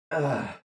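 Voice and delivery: low, soft effort grunt; strained grunt. Real, not acted. Straining on thick frozen bands.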